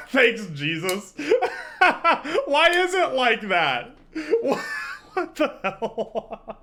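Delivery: slow words broken by high laughter; can barely speak for laughing; giggling through the line. Real, not acted. Still laughing at the clip.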